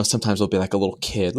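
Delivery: ordinary talk, nothing marked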